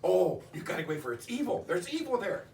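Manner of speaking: terrified high cry of who is that; aunt's frightened demand. Retold or imitated. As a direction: shriek at the stranger in the photo. Aunt screaming warning, retold.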